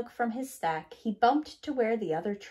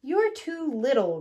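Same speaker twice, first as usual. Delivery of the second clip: louder, gruff dismissive truck voice; rumbling; 4 semitones higher, 6 dB louder than usual. A narrator's reading. Dump truck rumbling at the little bulldozer. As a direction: brush off the little one. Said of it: "rumbled Dump Truck".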